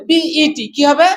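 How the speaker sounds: normal talking voice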